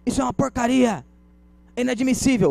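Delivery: high-pitched acted outrage; indignant customer's protest; angry customer. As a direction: storm in complaining, pitched high. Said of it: "O cliente mete o pé na porta".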